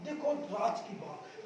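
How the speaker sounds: ordinary talk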